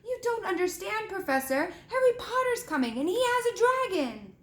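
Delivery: Malfoy whining to his teacher; whiny pleading boy. A narrator's reading. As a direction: plead like a caught schoolboy. Malfoy protesting to McGonagall.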